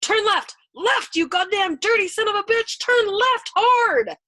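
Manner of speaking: high, furious, loud cursing; frantic screamed order. A narrator's reading. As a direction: scream directions at the pilot, stretching the last.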